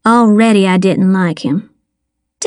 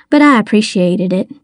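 ordinary delivery

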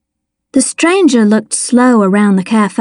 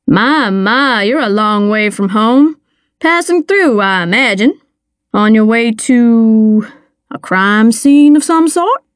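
prying small-town mayor. Acted. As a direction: play a chatty mayor, slowing as he probes. Mayor of the story speaking.

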